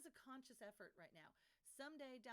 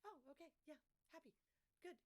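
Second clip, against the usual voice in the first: mock cheerful recovery; 6 semitones higher, faster than usual. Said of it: "she's like".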